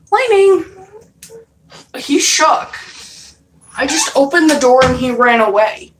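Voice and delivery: high, loud and fast, still rattled; excited exclaim. Real, not acted. Vlogger reacts to the cat bolting, high excited rush. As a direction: blurt it out, wound up.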